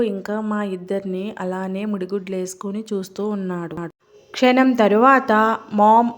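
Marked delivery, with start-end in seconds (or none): 5.7-6.1 loud call, voice rising at the end; shouting for mom. A narrator's reading. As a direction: yell for mom across the house. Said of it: "అని గట్టిగా అరిచాడు".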